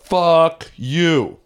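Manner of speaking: loud, drawn-out, high-pitched jeer; imitated heckler. Audience guy heckling the comic on stage, drawn-out jeer. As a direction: stretch both words like a crowd heckle.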